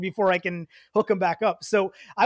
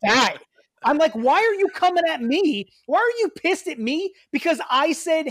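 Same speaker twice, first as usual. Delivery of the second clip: exasperated rant; 5 semitones higher, 4 dB louder than usual. Retold or imitated. Retold confrontation with neighbor.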